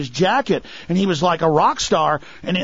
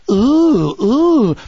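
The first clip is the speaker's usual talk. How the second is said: mock relishing ooh; 6 semitones higher, 5 dB louder than usual. Relishing power of killing.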